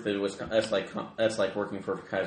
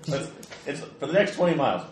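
ordinary talk

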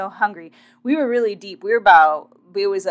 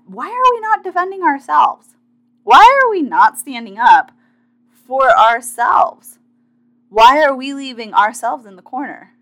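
impassioned; 5 semitones higher, 9 dB louder than usual. Real, not acted. Urging viewers to defend themselves.

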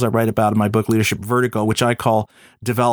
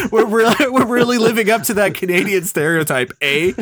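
laughing; 9 semitones higher than usual. Real, not acted. Joking about Canadian stereotype.

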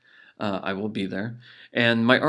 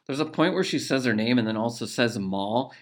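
ordinary delivery

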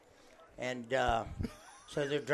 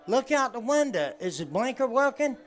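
higher, louder impatient question; driver asking; 10 semitones higher, 6 dB louder than usual. Retold or imitated. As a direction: call it over to her in the car. Driver asks blonde, voiced high.

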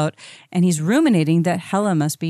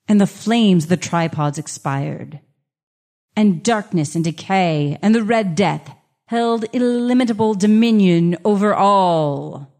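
gothic story narration; 3 dB louder than usual. Acted. Reads Poe narration.

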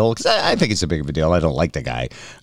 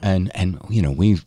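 normal talking voice

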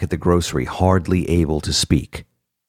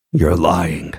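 ordinary delivery

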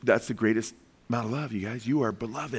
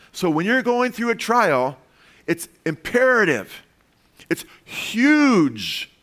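preaching at full force; 8 semitones higher, 4 dB louder, slower than usual. Real, not acted. Preaching peak.

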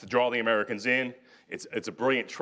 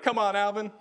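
high, coaxing plea from a pal; coaxing buddy; 9 semitones higher than usual. Retold or imitated. Drinking buddies coaxing Alvin to the bar, in a high wheedling voice. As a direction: wheedle him into coming along.

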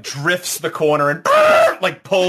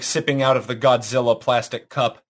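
ordinary delivery

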